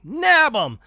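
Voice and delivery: sharp, very high and loud order; cartoon shout. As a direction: bark it at the henchmen. Crook orders the grab.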